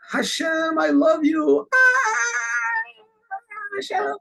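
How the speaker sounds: put-on very high-pitched boy's cry to God; imitated scream. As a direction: cry out shrilly like the rooster-imitating boy. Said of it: "and he screams out".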